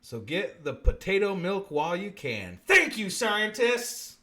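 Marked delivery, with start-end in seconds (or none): 2.7-4.2 exaggerated thanks. Mock gratitude to scientists.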